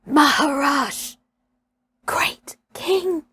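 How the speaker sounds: a hoarse, breathless whisper of awe; husky whisper. Acted. Buldeo whispering up to Mowgli in fear. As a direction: whisper it, terrified. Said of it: "he said at last in a husky whisper".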